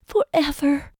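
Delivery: put-on high, devoted young woman; Lizzie's voice. A narrator's reading. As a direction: vow it high and true.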